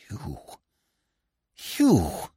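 faint then rising stunned repeat. A narrator's reading. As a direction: say it twice in disbelief. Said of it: "he repeated".

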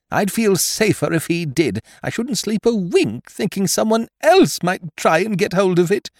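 very high-pitched fretful wife; agitated wife. Acted.

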